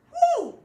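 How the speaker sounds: loud, high whistle-like whew; impressed whew. Real, not acted. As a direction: blow out an amazed whew. Impressed exclamation.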